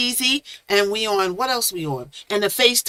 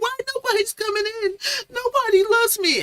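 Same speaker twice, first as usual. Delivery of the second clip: high-pitched, hammed-up crying complaint; mock wailing; 14 semitones higher than usual. Streamer recalling her empty broadcast, mock wailing. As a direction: whine it like a pretend sob. Retells broadcasting alone, acting hurt.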